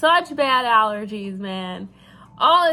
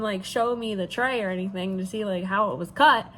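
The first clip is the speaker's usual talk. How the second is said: ordinary delivery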